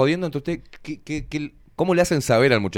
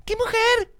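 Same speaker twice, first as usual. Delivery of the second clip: very high-pitched mock admiration; not a man's way; slower than usual. Man mocking how he is not meant to say it, high-pitched. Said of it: "El hombre no le grita".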